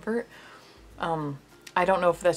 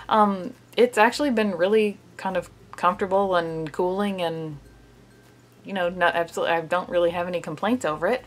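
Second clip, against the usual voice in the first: speaking with mask on face; through a sheet face mask; 3 dB louder than usual. Real, not acted. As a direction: lips framed by mask hole.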